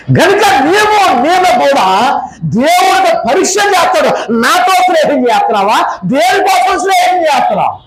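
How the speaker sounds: fervent preacher's challenge; preaching. Acted. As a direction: press the question on the congregation.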